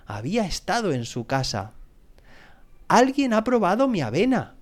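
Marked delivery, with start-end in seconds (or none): 2.9-4.6 storybook papa bear's indignation, pitched high; mock roar. A narrator's reading. Papa bear finding his oatmeal tasted, lightly roared. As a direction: exclaim it high, with a mild roar. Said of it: "rugió el oso papá".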